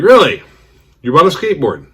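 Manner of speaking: sudden high, loud surprise; surprised friend. Retold or imitated. Friend on the phone reacting with loud surprise. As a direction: mimic the friend's shocked disbelief.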